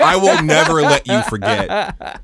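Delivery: mock menace.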